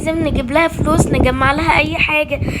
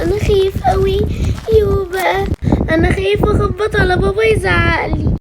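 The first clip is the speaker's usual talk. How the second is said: put-on high, fretful child's voice; scared child; 6 semitones higher, 3 dB louder than usual. A frightened boy afraid to knock at his father's door, whining. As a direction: whine anxiously, pitched up.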